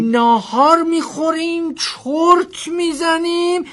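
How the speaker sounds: high, slow, drawn-out repetition; incredulous echo. Acted. Driver repeats passenger's nap plan, incredulous echo. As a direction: echo the plan slowly in high-pitched disbelief.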